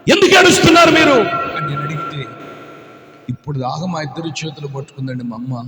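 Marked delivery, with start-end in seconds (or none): 0.1-1.2 "ఎందుకు ఏడుస్తున్నారు మీరు?" called out high and fast; loud, pressing question; urgent loud question. Acted. Asking crying girls urgently. 3.3-5.6 soft, low, hesitant telling; quiet little-girl report; timid girls' account. Acted. Voicing abandoned girls.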